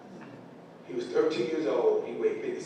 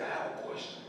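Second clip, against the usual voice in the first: faint, breathy little voice with almost no tone; a sick girl barely getting the words out; weak voice; 8 dB quieter, faster than usual. Retold or imitated. Daughter in hospital bed asks the doctor, weak voice. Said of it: "And in a weak voice".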